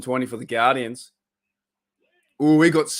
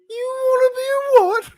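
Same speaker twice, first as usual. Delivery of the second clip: shrill-sounding squeaky voice, much higher, louder; high falsetto disbelief; 7 dB louder than usual. Retold or imitated. Fan quoting Life of Brian, squeaky high incredulous voice. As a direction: squeak it out in mock outrage. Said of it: "And he's like".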